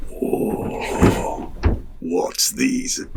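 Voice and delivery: breathy, unvoiced wonder; half-whispered imitation of amazed kids; awed kids. Kids marvel at motorbikes.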